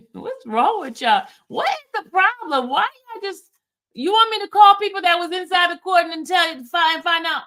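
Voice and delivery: what is wrong with you people; exasperated. Real, not acted.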